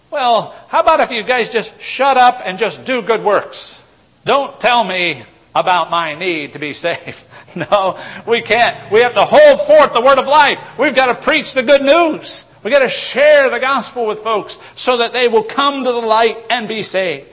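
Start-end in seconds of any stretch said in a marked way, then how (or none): 0.1-7.2 louder, higher sneering objector voice; mock unbeliever. Preacher mimics a scoffer brushing believers off, loud and sneering. 8.9-17.3 loud, high, driving sermon voice; fiery preaching. Acted. Preacher urges believers to share the gospel, loud and fervent.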